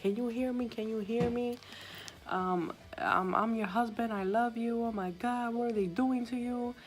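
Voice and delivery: high, imitating a frantic husband begging; mock desperate pleading, pitched well up. Husband begging his unresponsive wife, high mock desperation.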